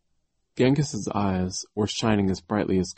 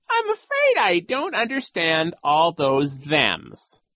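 high, slow, loud villain voice; oily mock bafflement; sly villain coach; 10 semitones higher, 6 dB louder, slower than usual. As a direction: feign confusion, drawn out and high.

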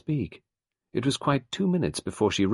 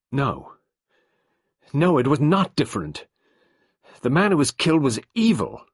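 higher and louder, denying firmly; wife insists, raised voice; emphatic wife; 8 semitones higher, 8 dB louder than usual. A narrator's reading. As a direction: insist the victim deserved it. Nadine retorts.

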